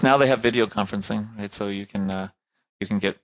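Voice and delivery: normal talking voice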